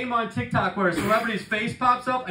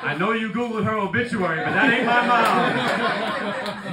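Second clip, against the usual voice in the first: drawn-out accusing scold with a laugh; mock accusation; 4 semitones higher, 3 dB louder, slower than usual. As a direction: call her out slowly, stretching it. Scolding the medium.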